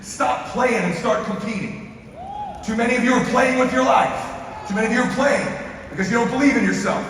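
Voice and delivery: fired-up motivational shout; preaching. Acted. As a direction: preach it at full force, rising.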